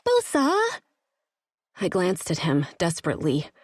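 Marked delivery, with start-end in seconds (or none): 0.0-0.8 high, frantic, hopeful cry; frantic call. Acted. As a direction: call his name frantically. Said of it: "expectant and a little frantic".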